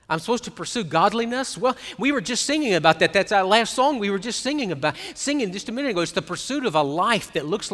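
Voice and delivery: loud, fervent pulpit delivery; full-force preaching. Acted. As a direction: preach it hard to the congregation.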